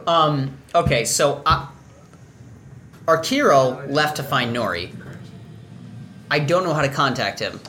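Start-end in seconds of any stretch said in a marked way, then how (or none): none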